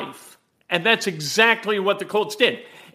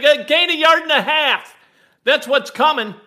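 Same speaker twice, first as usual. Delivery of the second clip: raised voice, half-yelled prediction; retold yell; 6 semitones higher, 5 dB louder than usual. Fan yelling his prediction at his wife, loud. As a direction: call it out loud like a fan sure of the play. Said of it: "I yelled at my wife".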